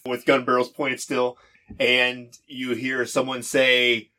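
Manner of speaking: normal talking voice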